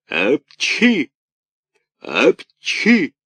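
a big high theatrical sneeze; loud mock sneeze. A narrator's reading. Karabas sneezes by the hearth, loud sneeze. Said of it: "с треском чихал".

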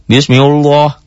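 "Bismillah" said wrongly as "Biusmiulloh" with a heavy, dark ending. Shown as a demonstration. Teacher mimicking students' common mistake, mock wrong reading. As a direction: deliberately thicken the "llah". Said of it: "jangan Bismillah".

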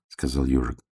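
usual speaking voice

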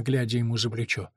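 usual speaking voice

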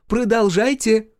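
high, loud teasing call; shouting from afar. Acted. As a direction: call back cheekily from the corridor. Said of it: "кричала она уже из коридора".

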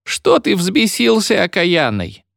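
put-on high, slow scolding of an old woman; high-pitched grumbling granny. A narrator's reading. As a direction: scold him like a shaken old cleaner. Old cleaner scolds Vanka.

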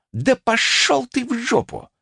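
high, angry bark; barked retort. A narrator's reading. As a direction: bark it back high-pitched. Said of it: "рявкнул я". (рявкнуть в ответ)

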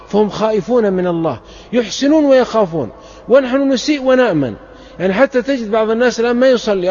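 fervent preacher pleading with listeners; impassioned preaching. Acted. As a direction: press the warning home to the crowd. Preacher contrasts them and us.

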